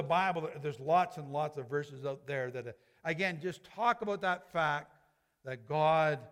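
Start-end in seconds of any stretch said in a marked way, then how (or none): none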